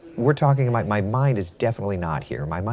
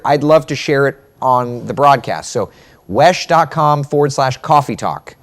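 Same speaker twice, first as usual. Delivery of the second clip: loud, raised voice across the room; louder and higher, calling out; calling to colleague; 5 semitones higher, 9 dB louder than usual. Real, not acted. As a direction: project it to someone off mic. Calls to a colleague off mic.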